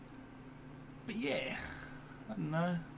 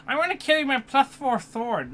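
hammed-up fantasy gamer; 11 semitones higher, 11 dB louder than usual. Mocking D&D player stereotype.

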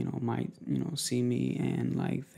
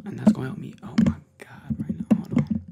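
louder, eager whisper; excited loud whisper; 10 dB louder than usual. Real, not acted. Vlogger plugging in headphones, excited loud whisper. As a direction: hiss it out in excitement.

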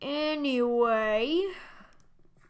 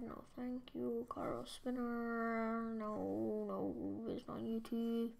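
whisper; 11 dB quieter than usual. Acted. Declining the ad.

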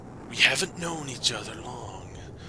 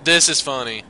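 normal talking voice

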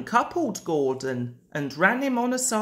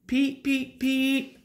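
high peeps, moderately raised; three short engine toots, pitched up; Henry's whistle; 7 semitones higher than usual. A narrator's reading. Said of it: "said Henry".

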